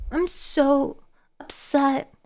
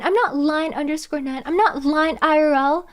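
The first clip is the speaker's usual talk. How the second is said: usual speaking voice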